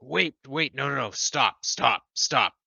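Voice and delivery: pleading shout. Retold or imitated. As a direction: yell at him to stop. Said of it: "I'm like".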